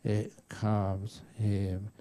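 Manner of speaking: slow it right down to soothe an angry husband; unhurried low calming voice. Shown as a demonstration. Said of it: "speak very slowly in a very low-pitched voice".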